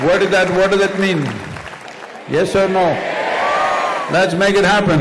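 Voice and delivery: loud, high rallying shout; rallying call. Real, not acted. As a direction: fire up the crowd, demanding an answer. Rallying the audience for an answer.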